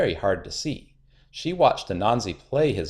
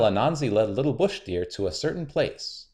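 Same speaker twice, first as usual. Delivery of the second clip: plain reading voice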